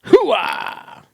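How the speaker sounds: Pacino's Hooah shout; loud gung-ho soldier. Retold or imitated. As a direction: bark Hooah like a colonel.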